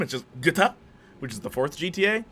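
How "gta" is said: GTA pronounced as a single word, not spelt out; said as one word. Shown as a demonstration. Explains the title is not an acronym, GTA said as a word. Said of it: "It's not like an acronym".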